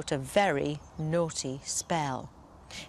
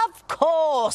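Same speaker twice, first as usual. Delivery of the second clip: grand dragon; 8 semitones higher, 7 dB louder than usual. Acted. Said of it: "said the dragon grandly".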